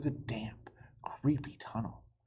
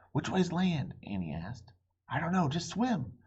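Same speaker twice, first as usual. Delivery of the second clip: high, loud anxious voices; urgent and scared, treading water; high loud anxious exchange; 7 semitones higher, 3 dB louder than usual. Acted. Kids swimming in dark moat.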